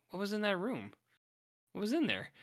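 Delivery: high-pitched, baffled questioning; baffled disbelief. Acted. Viewer passing a horrific room, baffled. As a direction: ask it high, as if unsure what you saw.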